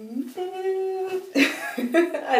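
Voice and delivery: long high-pitched fake crying whine; mock whimper. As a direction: whimper like you're dreading it. Acts out dread at returning to door.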